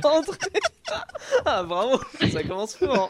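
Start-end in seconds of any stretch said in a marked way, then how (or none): 0.0-0.4 high shouted call. Acted. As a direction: call loudly toward the door. Calling out to the door.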